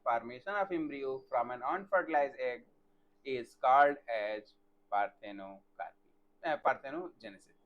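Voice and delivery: slow, word by word dictation for notes; teacher dictating a definition, slow and even. Shown as a demonstration. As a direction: spell it out slowly so students can write.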